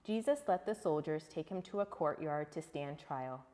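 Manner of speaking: plain reading voice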